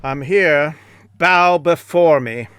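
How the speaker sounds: a lightly voiced self-important martial arts star; Steven Seagal impression. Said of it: "Sensei Steven Seagal".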